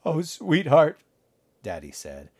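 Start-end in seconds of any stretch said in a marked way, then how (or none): 0.1-1.0 higher, louder, catching in the throat; voice hitching. Acted. Father to injured Natalie, voice catching. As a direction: choke up on the word. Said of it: "his voice hitching in his throat".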